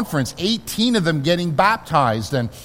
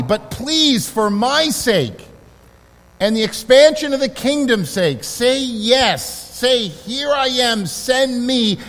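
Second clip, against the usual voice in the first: voicing Jesus; 4 semitones higher than usual. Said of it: "what he's saying is this".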